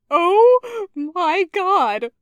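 high, loud, drawn-out words through laughter; acted helpless laughing. A narrator's reading. Taco reacting to the awful accent, laughing helplessly. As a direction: gasp it out slowly, cracking up. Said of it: "Taco burst out laughing".

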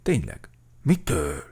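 hushed-down puzzled question; a touch of bemused wonder. A narrator's reading. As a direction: ponder it in mild amazement. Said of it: "álmélkodott Medve Medárd".

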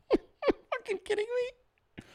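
tiny, very high strained voice; high strangled incredulous squeak; squeaky disbelief. Acted. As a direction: squeal it softly and slowly, dumbfounded. Squeaky disbelief at wife's reply.

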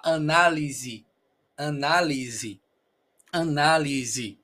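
"análisis" as a-NA-li-zi; final I. Shown as a demonstration. Teacher modelling Portuguese "análisis", E said as I. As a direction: say "análisis" with Z, ending on I. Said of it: "vas a pronunciar I".

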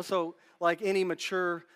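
ordinary talk, nothing marked

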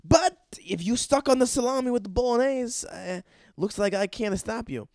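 high, loud Italian caricature voice; mock Italian. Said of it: "so Italian".

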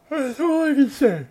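high, loud and drawn out; mock-exasperated high cry. Real, not acted. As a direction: belt the repeat up high. Repeats line, second louder.

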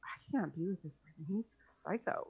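a soft whispered thought. Retold or imitated. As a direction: whisper it to yourself. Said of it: "I was just like".

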